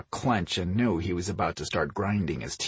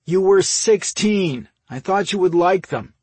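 loud, high, protesting grumble; grumbling; 10 semitones higher, 8 dB louder than usual. A narrator's reading. Brother defending his old gift, grumbling. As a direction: complain in self-defence. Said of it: "Mike grumbled".

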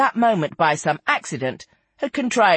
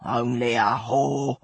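low, slow, forceful verdict; deep-set emphatic opinion; Lizzie, sudden force; 5 semitones lower, slower than usual. A narrator's reading. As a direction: pronounce it with sudden conviction, pitched down. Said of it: "opined Lizzie with sudden force".

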